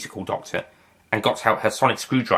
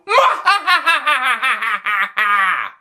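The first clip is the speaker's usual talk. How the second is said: evil cackle; 14 semitones higher than usual. Acted. Villain laughs after threat.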